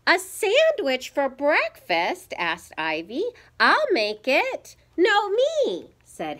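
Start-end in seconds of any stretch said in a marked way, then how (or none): 0.1-2.3 high, loud incredulous question; piping, astonished query; puzzled child. A narrator's reading. Ivy puzzled by Mama's request. 3.6-5.9 high, loud volunteering; excited little-kid clamour; eager children. A narrator's reading.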